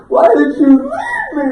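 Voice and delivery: high, loud wailing reproach; distraught wife. Retold or imitated. Husband imitating his exhausted wife, high accusing wail.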